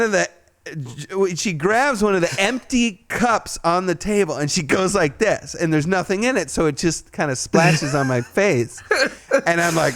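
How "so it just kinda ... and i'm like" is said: ordinary talk, nothing marked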